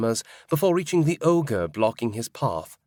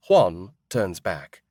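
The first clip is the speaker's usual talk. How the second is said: ordinary delivery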